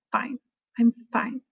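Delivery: quiet, reluctant I'm fine; a soft, grudging fine that hides trouble; not really fine. Shown as a demonstration. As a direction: say fine but mean the opposite. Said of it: "That's the tone of voice difference".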